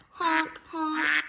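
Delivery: loud high honking like a goose; mock duck honks. Bully mocking her voice with honks, loud and high.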